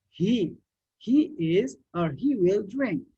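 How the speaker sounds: ordinary talk, nothing marked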